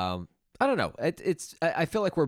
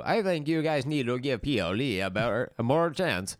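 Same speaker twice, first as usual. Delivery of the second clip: lightly voiced virtual assistant, pitched up; Siri; 4 semitones higher than usual. Siri butting in with an album review, mock assistant voice. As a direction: put on a bit of an assistant voice. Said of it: "Siri wants to give a, an album review".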